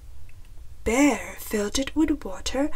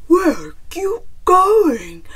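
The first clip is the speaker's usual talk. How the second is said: loud, raised bear voice calling out; huffing bear; 7 semitones higher, 10 dB louder than usual. A narrator's reading. Bear chases fleeing Chicken, loud huffing call. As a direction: call after her as a winded bear. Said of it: "huffed Bear, catching his breath".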